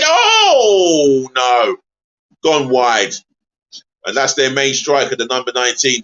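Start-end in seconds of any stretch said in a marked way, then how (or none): 0.0-1.8 sudden high, louder "oh"; loud groan. Real, not acted. A streamer reacting as the free kick flies wide, high and loud. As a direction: gasp as the kick goes wide.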